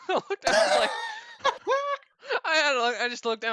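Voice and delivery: telling it through real laughter, high-pitched; laughing. Real, not acted. Gym storyteller recalling being caught looking, laughing. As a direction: crack up while talking. Telling own embarrassment, cracking up.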